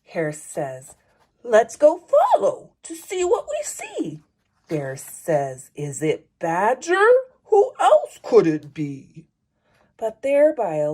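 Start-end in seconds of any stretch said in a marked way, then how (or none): 1.4-4.2 high, light little hare voice; small animal voice. Acted. Hare's line in the story. 5.7-9.3 low, gruff big bear. Acted. Bear's line in the story.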